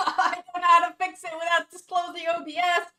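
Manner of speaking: loud words through laughter; laughing helplessly. Real, not acted. Streamer can't fix camera, laughing helplessly. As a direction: laugh while admitting you're stuck.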